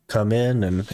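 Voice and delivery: ordinary talk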